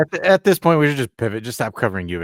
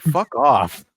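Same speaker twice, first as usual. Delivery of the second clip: mock snap; 6 semitones higher, 8 dB louder than usual. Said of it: "I'm like".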